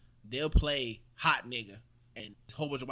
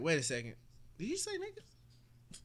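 delayed surprise; 4 semitones higher, 7 dB quieter than usual. Retold or imitated. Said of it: "you gonna be like".

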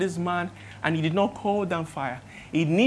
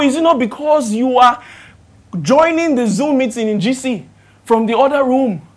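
loud, challenging pulpit questioning; full-force preaching; 5 semitones higher, 7 dB louder than usual. Acted. Preacher confronting church members about their excuses, forceful preaching. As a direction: press the congregation hard, voice raised.

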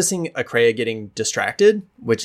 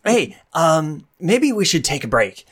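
higher, hesitant peacemaker voice; nervous Sorrel; 4 semitones higher than usual. Acted. Sorrel scrambling over to calm the fight, high and nervous. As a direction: stammer out a nervous suggestion. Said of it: "She goes".

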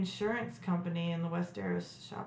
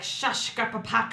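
mock fantasy language; 2 semitones higher, 4 dB louder than usual. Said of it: "whatever their language is".

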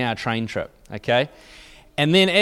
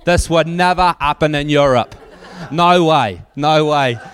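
loud indignant British complaint, half laughing; mock outrage, insisting it could never happen at home; hammed-up British tourists grumbling loudly; complaining British tourists; 4 dB louder than usual. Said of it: "these British people were saying".

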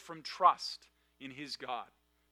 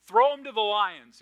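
mock crowd shout; 4 semitones higher, 8 dB louder than usual. Crowd calling for Daniel's death.

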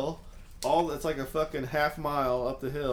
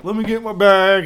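loud urgent call; raised frantic request; retold urgent call; 8 semitones higher, 14 dB louder than usual. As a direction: call it out loud and high, in a panic. Retold rushing to the truck.

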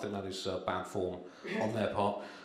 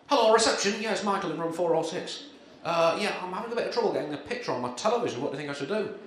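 lightly voiced, raised-pitch hotel guest call; polite guest on the phone to reception, slightly higher; 6 semitones higher than usual. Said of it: "my tragic impression of [name]".

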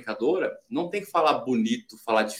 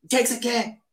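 higher-pitched, irritated comeback; rude snappy reply; 9 semitones higher, faster than usual. Shown as a demonstration. Busy person to someone asking a question, rude and irritated. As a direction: answer an interruption with irritation. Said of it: "o tom da nossa voz".

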